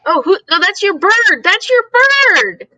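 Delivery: high, amused, drawn out; surprised realization. Real, not acted. Host realizing the sound came from the bird, high and drawn out. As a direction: laugh it off once you realize.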